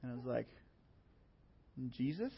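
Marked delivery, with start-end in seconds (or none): 1.8-2.3 timid answer. Retold or imitated. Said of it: "I was being pretty timid".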